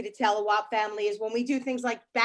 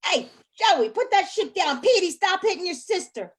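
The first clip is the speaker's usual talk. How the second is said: loud mock yelling at the kids; mama screaming; 3 semitones higher, 4 dB louder than usual. Mama yelling at her kids in the store aisle. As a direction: holler at the kids across the aisle. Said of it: "mama's screaming".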